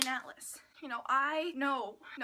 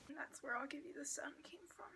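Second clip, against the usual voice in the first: whisper; 13 dB quieter, faster than usual. Real, not acted. Whispered aside.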